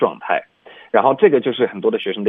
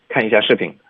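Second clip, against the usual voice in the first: ordinary talk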